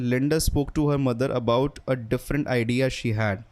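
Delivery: careful slow textbook reading; deliberate, slow read-aloud of an example. Shown as a demonstration. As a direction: read the sentence slowly, word by word. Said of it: "अगर आपको दिखाऊं तो".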